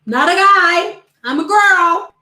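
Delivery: loud insistence. Retold or imitated. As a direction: set them straight, loudly. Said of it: "Edwards is like".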